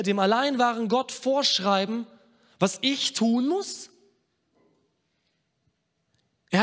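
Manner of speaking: high, forceful pulpit voice; high-pitched, insistent sermon delivery; impassioned preaching. Acted. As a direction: preach it with rising indignation, as God challenging the listeners.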